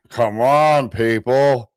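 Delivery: loud exasperated come on. Real, not acted. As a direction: plead with the crowd, exasperated. Exasperated appeal to audience.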